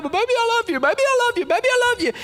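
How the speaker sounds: pleading husband. Retold or imitated. Husband begging wife at the door.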